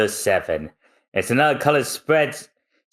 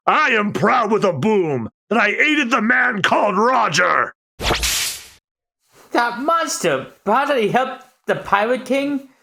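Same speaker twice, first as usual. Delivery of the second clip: outraged officials; 10 semitones higher than usual. Acted. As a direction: rant at the traitor. Judge and villain react.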